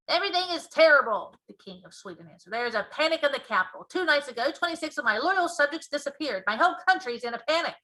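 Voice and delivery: loud, high, fast alarmed king; a king reporting disaster in a rush; loud, fast panicked monarch; panicky King of Sweden. A narrator's reading.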